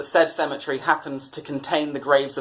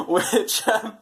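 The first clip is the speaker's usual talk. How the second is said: laughing through the word; 6 semitones higher than usual. Real, not acted. Laugh right after lyrics.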